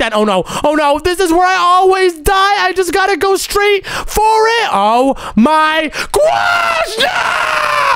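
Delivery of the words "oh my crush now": very high, drawn-out scream; panicked scream. Real, not acted. Gamer at the spot where they always die, panicked scream.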